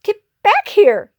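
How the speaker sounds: high, urgent little yell; yelled order. Acted. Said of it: "yelled Pip".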